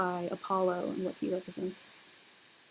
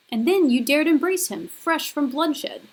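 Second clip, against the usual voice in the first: higher and louder, slowing on "fresh from bloodshed"; outraged Furies leader; 6 semitones higher, 7 dB louder than usual. Acted. Furies leader confronts Apollo, higher, louder outrage. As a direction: cry out the accusation, dragging the last words. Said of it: "the leader of the Furies is speaking to Apollo".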